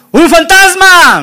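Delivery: loud, high cry of alarm; mock ghost alarm. Preacher mimics what Jesus did not say, mock alarmed cry.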